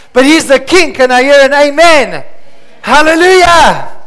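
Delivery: normal talking voice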